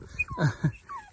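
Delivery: high giggle. Real, not acted. Delighted by the ponies.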